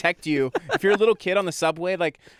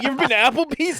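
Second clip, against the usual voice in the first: high, incredulous "you've been there?"; playfully acting shocked; mock disbelief; 8 semitones higher, 3 dB louder than usual. Friend mocks his own disbelief, with a high incredulous tone.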